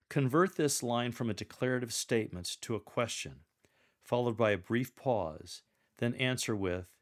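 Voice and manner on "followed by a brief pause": usual speaking voice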